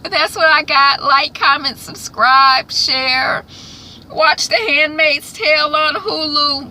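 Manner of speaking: high, loud cheerful sign-off; upbeat outro. Real, not acted. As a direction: sell the subscribe pitch brightly. Vlog sign-off call to action.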